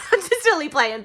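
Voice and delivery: laughing loudly as she admits it was a joke; giggly confession of playing. Real, not acted. Laughs off her fake crying.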